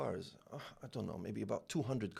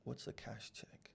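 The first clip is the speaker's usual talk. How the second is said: barely voiced, slower, near whisper; hushed confused question; 13 dB quieter, slower than usual. To his banker friend, a hushed baffled question. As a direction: ask it under your breath, lost.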